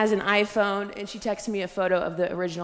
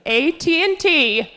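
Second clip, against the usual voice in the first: high, loud, slow mock mom; proud declaration; 8 semitones higher, 7 dB louder, slower than usual. Mom tells phone company she's leaving, loud proud declaration. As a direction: announce each letter loudly and slowly.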